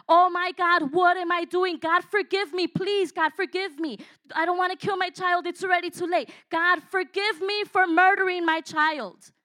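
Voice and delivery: loud, high cry of despair, begging God; anguished prayer. Retold or imitated. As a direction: plead with God in agony, near shouting. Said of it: "I remember thinking to myself".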